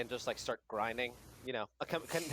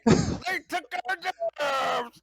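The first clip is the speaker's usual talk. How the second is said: Southern yell; 9 dB louder than usual. Acted. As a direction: bellow it like an angry hillbilly. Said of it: "You hit Southern real hard".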